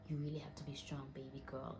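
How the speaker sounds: imitating a mother's soft, low comfort; murmured, barely voiced encouragement; hushed consoling. Retelling mother consoling her on phone.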